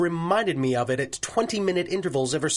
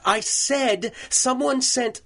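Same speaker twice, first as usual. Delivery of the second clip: raised, higher voice carrying through the wall; calling across office; 9 semitones higher than usual. Acted. Judge shouting the news to his secretary, raised. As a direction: call it out so she hears.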